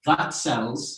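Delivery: crisp model of That sells; model phrase. Shown as a demonstration. Teacher models the next phrase, clearly. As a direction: say it clearly for copying. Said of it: "So it goes like this".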